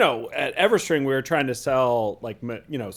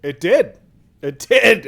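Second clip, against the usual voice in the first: triumphant; 5 semitones higher, 9 dB louder, slower than usual. Real, not acted. Confirming the sweet deal.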